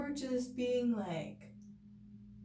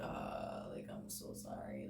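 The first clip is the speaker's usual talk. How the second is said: sheepish apology; 12 dB quieter, slower than usual. Retold or imitated.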